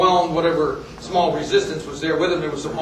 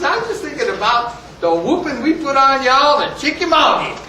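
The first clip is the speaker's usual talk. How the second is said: lightly voiced old soldier, pitched up; mock-innocent taunt, higher voice; amused old soldier; 6 semitones higher, 3 dB louder than usual. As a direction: needle the officer innocently.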